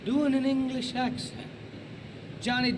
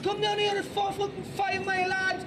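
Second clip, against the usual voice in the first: exaggerated, high-pitched Scouse challenge; Scouser accent; 9 semitones higher, 3 dB louder, slower than usual. Shown as a demonstration. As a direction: pick a fight in high Liverpool accent. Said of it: "So here's, I think, a Scouser".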